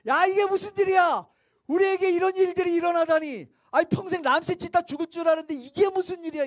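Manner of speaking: loud, high astonished cry of disbelief; amazed shout. Acted. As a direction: shout it like you can't believe your luck. Israelites amazed at the exodus. (믿기지 않는 듯 높고 크게 외치는 놀란 목소리)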